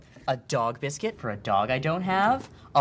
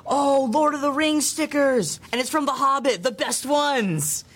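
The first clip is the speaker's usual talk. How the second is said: excited; 10 semitones higher, slower than usual. Acted. Gushing over stickers.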